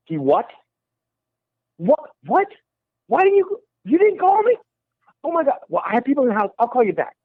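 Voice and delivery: high-pitched, incredulous imitation of a caller; shocked phone reaction. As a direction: act out getting shocking news by phone. Retold phone call, shocking news.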